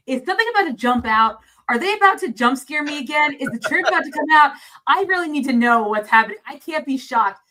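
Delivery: mildly hammed-up nervous anticipation, pressing for answers; mock suspense. Viewer re-enacts waiting for a jump scare, mock anxious. Acts out anxious thoughts while watching.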